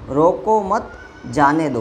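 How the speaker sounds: "रोको मत" joined, clear break, then falling "जाने दो"; group "रोको मत", stop, drop on "जाने दो"; pause after मत. Shown as a demonstration. Teacher showing the meaning of letting go, pause after "मत". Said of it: "अर्थात जाने के भाव में".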